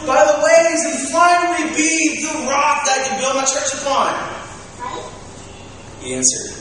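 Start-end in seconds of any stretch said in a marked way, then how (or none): 0.1-4.1 high, loud, slow preaching push; impassioned appeal. Acted. Jesus pressing Simon about his love, loud impassioned appeal. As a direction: press the question urgently, stretching key words.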